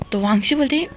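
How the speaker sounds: ordinary delivery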